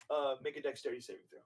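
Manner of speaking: ordinary talk, nothing marked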